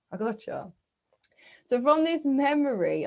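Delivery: ordinary talk, nothing marked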